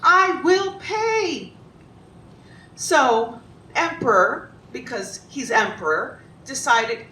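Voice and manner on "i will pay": high, very slow plea; begging. Acted. Carpenter begging the emperor to spare him, slow high pleading. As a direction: promise desperately, each word drawn out high. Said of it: "Ali begged".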